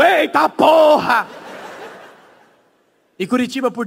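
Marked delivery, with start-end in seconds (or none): 0.0-1.3 grito de espanto. Retold or imitated. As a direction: shout of amazement, drawn out with a laugh. Turma gritando no brinquedo. (grito lento e risonho de espanto)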